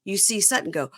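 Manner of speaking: ordinary talk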